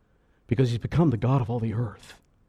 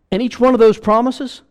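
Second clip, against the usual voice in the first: preaching at full force; 10 semitones higher, 9 dB louder than usual. Acted. Preacher surges on promises.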